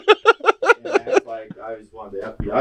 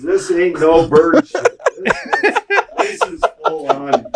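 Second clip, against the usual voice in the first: louder laughing dad quote; laughing mock rant; 7 semitones higher, 4 dB louder than usual. Dad ranting, laughing retelling.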